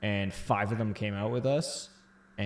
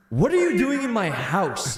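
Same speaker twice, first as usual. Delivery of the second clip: slow, high, louder indignant demand; annoyed roommate; 10 semitones higher, 7 dB louder than usual. Retold or imitated. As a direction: demand it like an irritated host. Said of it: "he was just like".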